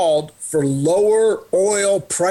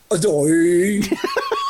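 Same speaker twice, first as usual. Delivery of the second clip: short laugh; 2 semitones higher than usual. Real, not acted. Laughing at lawnmower warning.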